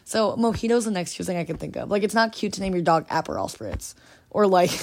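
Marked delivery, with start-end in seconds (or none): none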